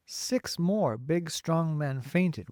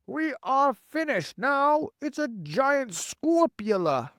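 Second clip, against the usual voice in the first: high, slow wail of doom; panicked yell; 11 semitones higher, 4 dB louder, slower than usual. A narrator's reading. Fire chief facing a giant spider, yelling in panic. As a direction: yell it as if all is lost. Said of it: "yelled the head of the fire department".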